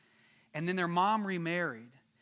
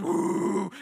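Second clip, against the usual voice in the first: loud, sustained whooshing woo; imitated tornado howl; 6 dB louder than usual. Shown as a demonstration. Acting out a tornado for orphans, loud howling noise. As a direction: howl like a storm wind. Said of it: "That's my tornado noise".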